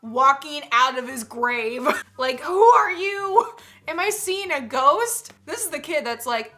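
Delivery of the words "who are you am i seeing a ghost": high, slow mock fright; mock spooked. The grandson meeting the twin, loud mock fright. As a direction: play the startled grandson half-jokingly.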